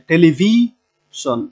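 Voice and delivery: teacher's drawn-out television, stress on ভি; penultimate stress. Shown as a demonstration. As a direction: lean long on ভি in television, then let শন drop. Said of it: "শনের আগেরটাতে জোর দিতে হবে".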